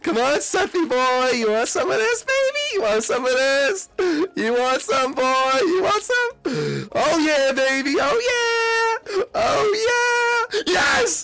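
wildly excited high shouting; over-hyped gamer celebration, voice way up; too hyped. Real, not acted. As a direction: taunt then roar in high-pitched triumph. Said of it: "I'm getting too hyped over this game".

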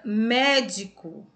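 "médico" with a punched first syllable; stress on MÉ. Shown as a demonstration. Teacher giving a proparoxítona example, "médico" stressed firmly on "mé". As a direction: hit the "mé" of "médico" hard. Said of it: "mé é a sílaba mais forte".

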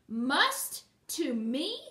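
loud, sharply rising questions; haughty, indignant yell; screamed outrage. A narrator's reading. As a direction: throw it back in furious disbelief. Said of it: "screamed the princess".

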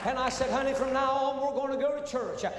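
high, loud preaching climax; preaching at full force. Acted. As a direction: lift the whole testimony into a loud, high preacher's cry. Preacher builds testimony to climax.

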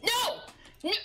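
sudden high yelled no; shouting no. Real, not acted. Seeing the dog grab his turkey, high shout. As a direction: yell it to stop the dog.

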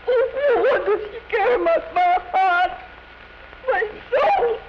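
tearful lament. Acted. Singer lamenting to her guest.

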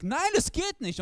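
high, whiny refusal; clutching the books, squeak it out. Retold or imitated. Ich wehre ab. (hohes, quengeliges Nein)

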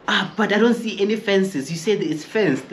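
high, lightly voiced gotcha; mock smug coworker. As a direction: play the guy who thinks he won. Imitating the coworker.

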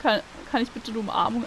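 usual speaking voice

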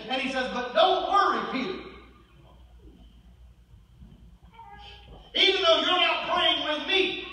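high, forceful sermon delivery; impassioned preaching. Acted. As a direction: preach it urgently, hammering each point.

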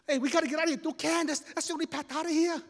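high, loud panicky cry; panicked. Acted. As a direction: cry out like a trapped kid. Boys trapped on the path.

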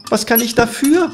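high, protesting cry. A narrator's reading. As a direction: exclaim it in self-defence, pitched high. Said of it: "schrie der Läufer".

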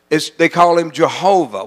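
normal talking voice

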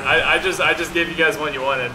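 ordinary delivery

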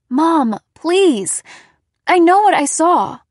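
loud, high, pleading insistence; insistent protest. A narrator's reading. Daughter begging doubtful mom to believe her, insistent.

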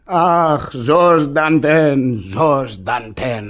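very slow lament that fades; rueful self-address. Acted. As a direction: scold yourself by name, slowing right down. Self-reproach in a monologue.

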